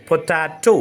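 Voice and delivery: പൊട്ടാറ്റോ said clearly as po-TAA-to; clear pronunciation. Shown as a demonstration. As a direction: model a clearly articulated പൊട്ടാറ്റോ, ending in a round "to". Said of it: "clear സ്റ്റൈലിൽ". (പൊട്ടാറ്റോ വ്യക്തമായ ശൈലിയിൽ, അവസാനം "റ്റോ" തെളിച്ച്)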